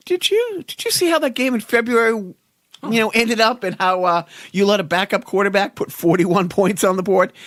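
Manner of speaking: high-pitched mimicking of baffled fans; incredulous mock question. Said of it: "people are like".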